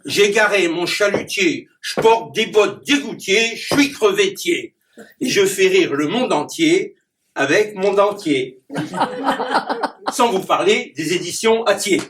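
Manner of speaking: declaimed rhyming verse with punchy rhyme endings; poem recitation. Acted. Clown reciting his rhyming poem to the audience. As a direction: perform the poem for the audience, landing each rhyme.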